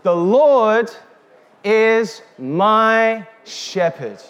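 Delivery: slow, emphatic recitation; declaimed verse, drawn out; quoting scripture. Shown as a demonstration. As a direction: give each word weight. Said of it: "we said this".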